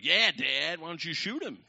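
high, loud imitation of children; kids goading Dad. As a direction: pipe up like kids egging Dad on.

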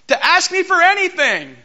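high, loud incredulous cry; indignant outburst. Acted. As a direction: throw the question back at him, outraged. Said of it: "that is not how it sounded".

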